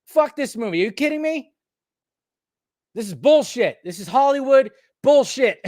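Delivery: retold angry rant. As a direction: spit it out in disgust. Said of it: "I said".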